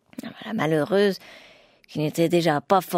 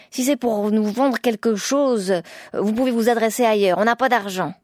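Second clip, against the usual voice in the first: higher, louder suspicious dismissal; curt rebuff; 4 semitones higher, 4 dB louder than usual. Acted. Wife turns away a stranger at the door, curtly. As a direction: send the unwanted caller away.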